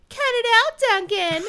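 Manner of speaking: high, loud whiny taunt; mock him in a nasal sing-song; whiny sing-song mimic. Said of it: "mimicked Duncan in a whiny sing-song voice".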